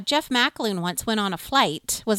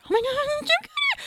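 very high breathless fan squeal; starstruck squeal. Retold or imitated. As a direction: squeak out the star's name like a giddy fan. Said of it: "We're like, Laverne and Shirley".

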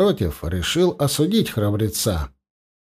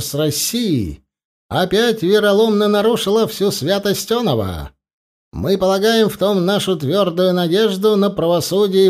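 proclamation read aloud; 5 semitones higher than usual. Acted. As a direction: read the manifesto out to the troops. General reads the manifesto.

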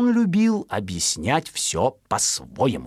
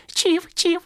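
very high twittering bird call; high chirping sparrow mum; encouraging chirp. Acted. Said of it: "ободряла его".

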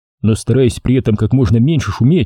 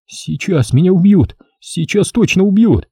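high-pitched dread; anxious, raised-pitch babble; frightened babbling; 7 semitones higher than usual. A narrator's reading. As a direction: fret aloud, sure of being killed. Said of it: "снова начинает бормотать".

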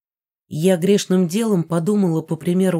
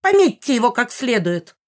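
put-on commanding old woman; 6 semitones higher, 3 dB louder than usual. Megara commands the cats.

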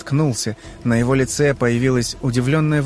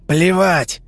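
defiant shout; 6 semitones higher, 3 dB louder than usual. Acted. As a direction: spit it out high and loud. Defiant reply in fight.

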